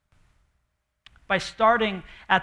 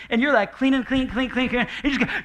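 higher, faster harried rush; frantic cleaning; 6 semitones higher, 4 dB louder than usual. Retold or imitated. Pastor acting out Thanksgiving prep, harried and rushing. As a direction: rattle it off like you're racing the clock.

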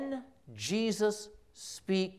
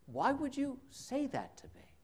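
a lightly voiced, higher, softer wounded question; hurt Peter; 8 semitones higher, 8 dB quieter than usual. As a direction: ask it stung and bewildered. Peter hurt by Jesus' rebuke.

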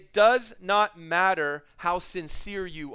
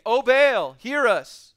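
calling out; 5 semitones higher, 9 dB louder, much slower than usual. Acted. Prophets calling on Baal.

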